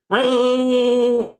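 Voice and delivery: high sustained grinder whine; high drawn-out machine noise; imitated grinding noise. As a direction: mimic a knife on a grinder, long and high. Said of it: "All of a sudden, I hear".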